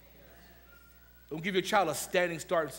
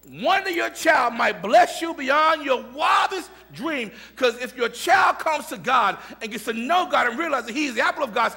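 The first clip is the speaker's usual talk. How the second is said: rousing sermon; 8 semitones higher, 10 dB louder than usual. Acted. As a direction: lift the mothers up with it. Preaching at full force.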